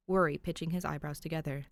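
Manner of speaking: plain reading voice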